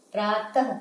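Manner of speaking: प्रातः with a breathy ह after the vowel; correct visarga. Shown as a demonstration. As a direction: model प्रातः for students. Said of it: "प्राय नहीं".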